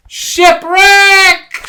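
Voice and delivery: very high, very loud cry; shouting. Acted. Rabbit announces the shipwreck, very high shout. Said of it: "shouts Rabbit".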